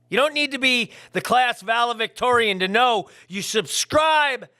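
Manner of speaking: loud, high shout at the viewers; yelling. Real, not acted. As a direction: bellow it like a drill sergeant, half joking. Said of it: "and I'm yelling".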